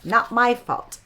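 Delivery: a hint of a huffy blue jay; blue jay's excuse. Host previewing Bluster's excuse, lightly voiced. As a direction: toss off the excuse like a sulky bird. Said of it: "That's what he said".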